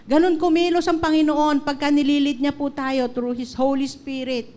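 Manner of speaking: full-voiced pulpit declaration; fervent preaching. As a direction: proclaim it to the congregation with conviction. Preaching climax on the Holy Spirit.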